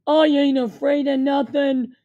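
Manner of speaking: put-on loud, slow tough-kid bark. A narrator's reading. Josh barking at the gangsters, loud and defiant. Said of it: "Josh barked".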